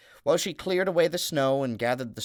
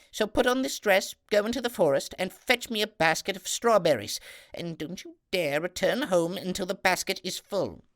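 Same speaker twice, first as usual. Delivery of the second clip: much higher, louder domineering stepmother; stepmother ordering; 9 semitones higher, 4 dB louder than usual. Acted. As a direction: bark out orders as the cruel stepmother.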